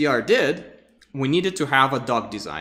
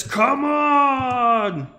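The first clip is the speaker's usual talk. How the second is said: high, loud shouted challenge; Viking battle cry; 11 semitones higher, 4 dB louder than usual. Pretend. The Viking shouts his challenge at the spider, a high, loud battle cry. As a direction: roar it at the enemy. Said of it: "and says".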